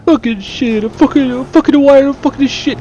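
very high mock Italian cursing; stressed-out Italian accent. Gamer voicing another flustered character cursing, mock Italian accent. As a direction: curse away in a hammed-up Italian accent. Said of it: "Now he's Italian too".

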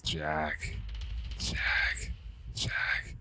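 unvoiced hiss fading toward a whisper; ghostly hiss. A narrator's reading. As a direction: call the name like a spirit circling closer. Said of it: "the voice hissed".

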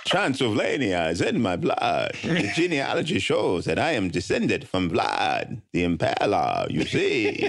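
slow, drawn-out Transylvanian vampire; hammed-up vampire accent, stretched out slowly; Dracula voice. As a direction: play Dracula reading a grand statement, savoring each word. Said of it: "Yeah, do a Dracula".

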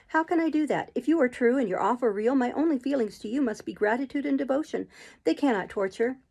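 Jane, earnest protest. Acted. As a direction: protest with heartfelt sincerity.

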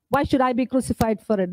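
ordinary talk, nothing marked

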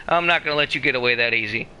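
ordinary talk

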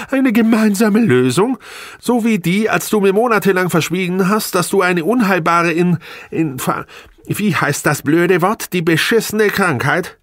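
very high, loud, outraged mockery; hysterical. A narrator's reading. Wife mocking husband's hidden diagnosis, hysterical. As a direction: throw it back shrieking, nearly hysterical. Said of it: "Sie lachte hysterisch auf".

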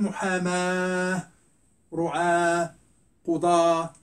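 محاماة، رعاة، قضاة each ending in "aah", no t; ta pronounced as ha. Shown as a demonstration. Teacher summing up examples, محاماة، رعاة، قضاة ending in h. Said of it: "إذا صح نطقها هاء بحال".

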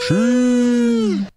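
loud high filtered Wookiee roar; Chewbacca voice. Pretend. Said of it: "Chewy voice?".

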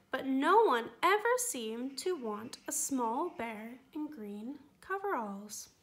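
ordinary delivery

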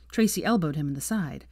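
ordinary delivery

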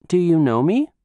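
put-on high, curious child's question; curious little girl. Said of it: "asked Nozomi curiously".

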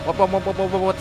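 rapid-fire engine stutter sound; imitating rev limiter. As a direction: mimic an engine bouncing off the limiter. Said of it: "นี่คือเสียงรอบมันตันแล้ว". (เลียนเสียงรอบเครื่องตันรัวๆ)

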